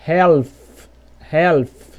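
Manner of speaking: "half" said as "helf", L audible; wrong sounded L. Shown as a demonstration. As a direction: voice the L before F in "helf". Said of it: "Você não vai falar".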